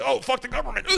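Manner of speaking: lightly voiced scoffing listener; mock complainer. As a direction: toss it off like a naive critic.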